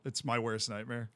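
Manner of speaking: ordinary delivery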